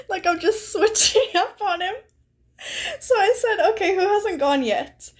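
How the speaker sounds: high-pitched laughing through the words. Real, not acted. Streamer laughing at turning on Clef mid-game, giggling high. Laughing at own switching sides.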